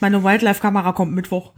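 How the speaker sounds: ordinary talk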